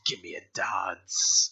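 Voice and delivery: whispered, leering come-on; sleazy whisper. Acted. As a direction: breathe it like a creepy flirt. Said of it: "It's the voice".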